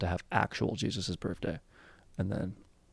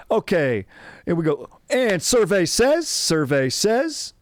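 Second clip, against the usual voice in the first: booming, high game-show host shout; big drawn-out host bellow, pitched way up; Steve Harvey; 10 semitones higher, 6 dB louder, slower than usual. Acted. Comic playing game show host revealing the survey, hammed-up impression. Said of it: "It's my Steve Harvey".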